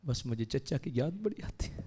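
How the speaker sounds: very soft, near-whisper; hushed sorrow. Acted. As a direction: confide a painful memory under the breath. Prophet remembering his slain uncle. (دبی ہوئی، سرگوشی جیسی آواز)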